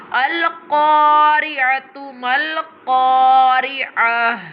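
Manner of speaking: thick, drawn-out Qaf in "Mal-Qari'ah". Shown as a demonstration. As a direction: recite "Al-Qari'atu" slowly, heavy Qaf held long. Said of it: "always pronounced with the thicker voice".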